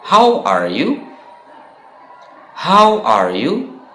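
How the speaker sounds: How are you pitched high with a rising end; cheerful classroom How are you; model question. Shown as a demonstration. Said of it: "kita bisa mengatakan".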